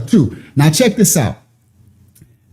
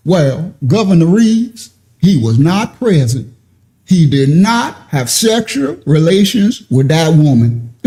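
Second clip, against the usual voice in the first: slow, drawn-out Southern country accent imitating the spokesman; lightly hammed-up Southern drawl; mock country twang; 3 semitones lower, slower than usual. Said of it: "my country brothers and sisters with a twang".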